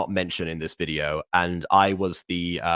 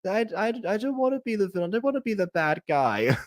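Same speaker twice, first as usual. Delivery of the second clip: put-on whiny kid; 13 semitones higher than usual. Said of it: "I was like".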